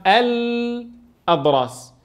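الأضراس stretched very slowly, fatha carried onto the lam, aladras; slow teaching demo of الأضراس; very slow demonstration. Shown as a demonstration. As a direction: draw out الأضراس syllable by syllable. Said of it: "حطيناها على اللام".

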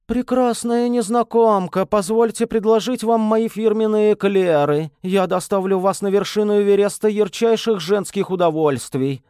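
mock the flirt in a squeaky voice. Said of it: "изобразила его манеру речи песклявым голоском".

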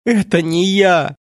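high, drawn-out wail of despair; acted anguished outcry; desperate cry. A narrator's reading. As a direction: cry out as if breaking into sobs. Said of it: "полный отчаяния крик, переросший в рёв".

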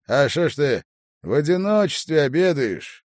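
hearty, loud and high; cheerful voice. A narrator's reading. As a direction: call out cheerily to a friend. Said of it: "бодрый голос". (бодрым, громким голосом)

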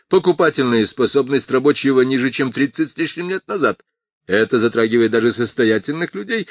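passionate speechmaker voice; a politician addressing a crowd; Morton's speech. A narrator's reading.

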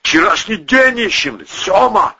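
high, strained hoarse voice; hoarse reply. A narrator's reading. Battered Volyna answering the boss, hoarsely. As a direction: answer back with a rasp. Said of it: "хрипло ответил Волына".

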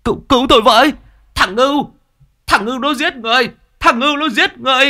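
very high, loud panicked scream; desperate high cry of murder; screaming for help. Acted. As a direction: shriek for help from the water. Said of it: "ông Đại gào lên".

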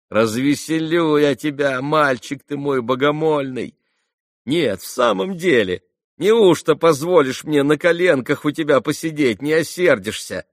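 высоко, громко, кокетливо; заигрывай с робким юношей; игривая Грушенька. Acted. (игриво, оживлённо уговаривает)

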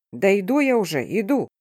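раздражённый окрик, сыгранный вполсилы; негромко гаркнуть в ответ на стук; рявканье. A narrator's reading. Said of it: "гаркнул он". (сдержанно рявкнуть)

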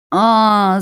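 a sudden loud high yell of outrage. A narrator's reading. Daughter yells at her mother over the phone, loud and high. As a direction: burst out in an indignant yell. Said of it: "заорала я".

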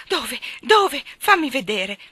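high, urgent, breathless questions. A narrator's reading. Miss Lark asking where her lost dog is, breathless. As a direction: blurt it out, out of breath with agitation. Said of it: "disse Miss Lark senza fiato".

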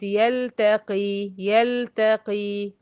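يلتقي with the lam sounded clearly; slow, lam pronounced plainly. Shown as a demonstration. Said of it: "نطقتها ولا ما نطقتهاش".

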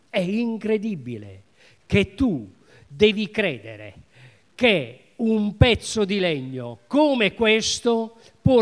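loud, fervent preaching that builds up; full-force preaching. Acted. Preacher tells the congregation about faith, loud and fervent. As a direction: preach it hard, rising to a peak.

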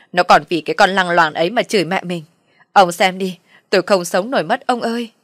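loud, distraught lament to a husband; acted loud, plaintive crying out; tearful wailing. A narrator's reading. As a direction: wail to your husband for sympathy. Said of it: "nắm lấy tay ông mà khóc lóc rằng".

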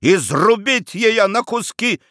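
high, drawn-out furious threat; enraged squeal. A narrator's reading. Son about to slash the portrait, enraged. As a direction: threaten it slowly at a high pitch, sword in hand. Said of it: "взвизгнул Феофил и схватил шпагу".

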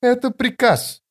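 high-pitched, insistent command; firm order. A narrator's reading. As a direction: pull rank, pitched well up. Officer insists on her order.

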